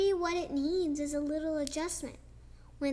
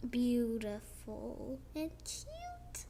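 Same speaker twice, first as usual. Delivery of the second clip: slow unvoiced whisper, tender; soft admiring whisper; 3 semitones lower, 7 dB quieter, slower than usual. Real, not acted. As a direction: breathe it out in awe. Admiring cranes softly.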